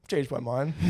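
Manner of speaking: deadpan blurted reversal; mock abrupt announcement. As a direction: toss it out deadpan. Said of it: "you just randomly are like".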